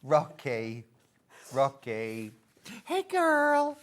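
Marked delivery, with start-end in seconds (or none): 2.9-3.8 higher, louder cutesy put-on voice; playful toy voice. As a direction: greet like a sassy toy. Voicing the toy.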